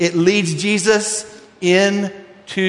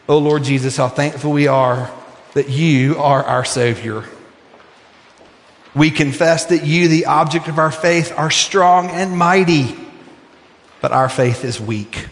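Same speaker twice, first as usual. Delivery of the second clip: closing prayer; 3 semitones lower than usual. Acted.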